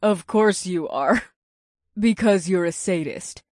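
low, soft, slow seductive purr; succubus purr. A narrator's reading. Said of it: "The succubus purred".

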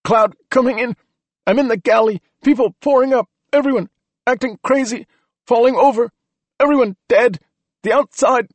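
high, loud panicked bursts with breaks; breathless, broken panic; gasping and wheezing. A narrator's reading. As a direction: force out a dying radio report. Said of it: "He gasped and wheezed between every other word".

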